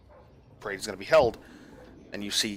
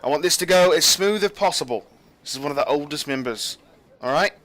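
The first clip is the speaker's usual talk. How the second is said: raised, high, commanding briefing; captain briefing; 9 semitones higher, 8 dB louder than usual. Acted. Captain briefing her guards on the parade, firm and raised. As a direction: lay out the plan like a stern captain.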